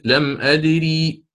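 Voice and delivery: لم أدري slowly, final ya kept long; full form with final ya. Shown as a demonstration. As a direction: draw out أدري with the ee sounded. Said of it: "تقول".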